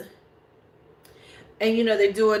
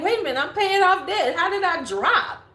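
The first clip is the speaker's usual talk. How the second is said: high, bewildered disbelief; mock baffled complaint about a credit score; baffled self-quote; 9 semitones higher than usual. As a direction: pitch it up, stunned at the drop. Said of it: "'Cause I'm like".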